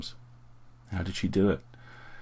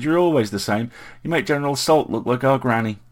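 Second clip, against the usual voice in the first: higher, louder teasing rebuke; mock outrage; 5 semitones higher, 6 dB louder than usual. A narrator's reading. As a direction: tease him with exaggerated exasperation. Brother's playful scolding goes on.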